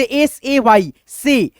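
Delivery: letters spelled out one by one, very slowly; slow spelling. Shown as a demonstration. Teacher spelling S-A-Y for students, very slow and deliberate. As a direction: spell it out letter by letter at half speed. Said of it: "A Y से दोस्तों आप लोग ए बोलेंगे".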